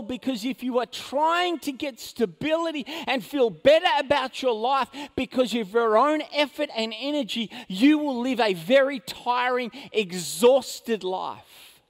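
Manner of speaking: loud, rising sermon delivery; forceful preaching. Acted. Preacher warning the congregation, loud and driving. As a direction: press the point home to the church.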